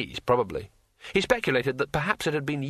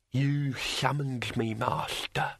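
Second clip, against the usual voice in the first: telepathic dragon voice; 4 dB quieter, much slower than usual. Acted. Dragon speaks.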